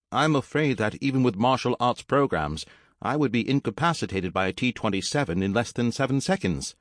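high-pitched robot reciting a rule; high robot butler voice; formal robot servant. Acted.

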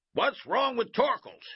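loud, high coach's question; demanding shout. A narrator's reading. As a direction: call it out sharply as an irritated coach.

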